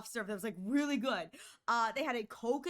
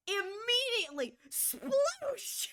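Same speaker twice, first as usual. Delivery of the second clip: high excited squeal; high-pitched splash squeal; sploosh; 9 semitones higher, much slower than usual. Retold or imitated. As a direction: shriek the splash like a kid. Spilled boba in the car.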